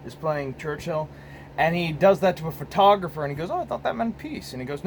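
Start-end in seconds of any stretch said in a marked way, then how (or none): 3.5-4.5 higher-pitched innocent protest; mock innocent quote. Imitating Churchill explaining himself to a photographer, mock innocent. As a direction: act out a mild, higher-voiced excuse. Voicing Churchill film exchange.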